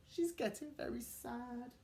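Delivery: ordinary delivery, nothing marked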